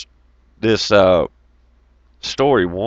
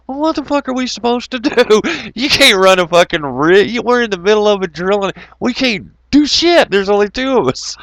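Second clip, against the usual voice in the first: high, laughing disbelief; pitched-up mock alarm; incredulous outburst; 11 semitones higher than usual. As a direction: retell the panic half-laughing. Retold panic with tool pusher.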